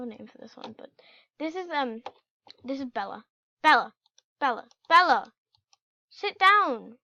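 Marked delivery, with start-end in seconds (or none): 3.6-5.3 loud call to the dog. Real, not acted. Player calling her dog by name, loud call. 6.1-6.9 firm raised command. Real, not acted. Player ordering her pet dog, firm command.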